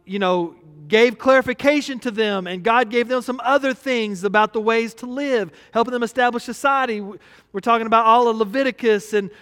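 ordinary talk

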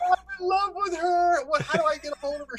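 high breathless infatuation; smitten. Retold or imitated. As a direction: fall for the DJ on the spot. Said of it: "I'm like".

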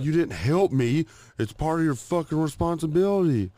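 high, loud imitated telling-off; retold angry yelling. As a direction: scold as his exasperated wife. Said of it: "she was yelling at me".

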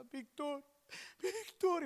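high, urgent cry of a name; distraught calling. Retold or imitated. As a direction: imitate a woman desperately calling for help. Retells distraught friend calling him. (llamado angustiado y agudo)

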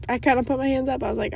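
normal talking voice

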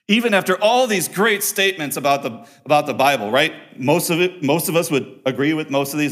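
loud, high, driving preacher's voice; full-force preaching. Acted. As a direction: preach it out hard to the congregation.

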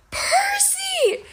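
very high, stretched-out goat-like cry; bleating. A narrator's reading. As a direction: bleat the name in a squeal of joy. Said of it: "Grover bleated".

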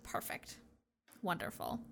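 very soft, near-whispered aside; barely audible plotting to oneself; retold hushed scheming. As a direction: murmur it low, imitating a schemer. Retelling Caroline scheming after Liam's answer.

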